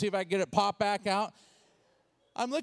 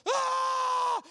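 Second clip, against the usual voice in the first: loud, high imitated shriek; long piercing panic scream; both screaming; 5 dB louder than usual. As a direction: scream like mother and baby together. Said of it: "they're both screaming".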